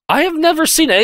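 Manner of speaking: high, excited disbelief; amazed. Real, not acted. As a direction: say it in sheer amazement. Amazed at a wrestling spot.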